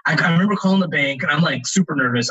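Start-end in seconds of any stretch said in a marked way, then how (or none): none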